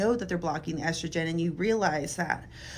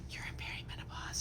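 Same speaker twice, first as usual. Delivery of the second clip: hushed whisper; whispering a diagnosis to a tearful patient; 15 dB quieter than usual. Retold or imitated. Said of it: "I was like".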